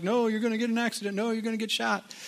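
high-pitched, hurried mimicry of naysayers; mock nagging warnings.

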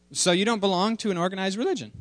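high, quick cartoon child voice; little daughter. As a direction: ask like a curious small girl. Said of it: "I'll read the cartoon to you".

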